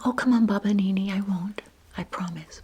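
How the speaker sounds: quick, coaxing opening that eases into a promise; coaxing grandson. Retold or imitated. Grandson begs grandma for her channel name, coaxing.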